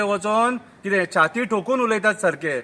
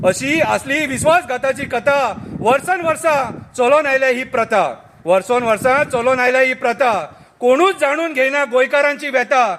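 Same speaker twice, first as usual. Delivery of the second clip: satirical poem recital; 5 semitones higher, 3 dB louder than usual. Acted. Reciting the turncoats' confession to friends, declaimed verse.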